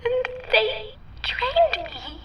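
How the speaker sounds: slow, high and trembling; tearful. Acted. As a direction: drag it out through tears. Tearful, slowed.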